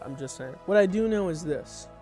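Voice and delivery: ordinary talk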